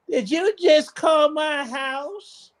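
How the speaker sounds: high, slow, suspicious woman's voice. Retold or imitated. Wife on the phone, demanding to know who called. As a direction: play the wary wife, high and drawn out.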